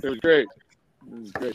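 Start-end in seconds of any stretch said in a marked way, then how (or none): 1.1-1.5 quiet. Real, not acted. Repeat fades to murmur.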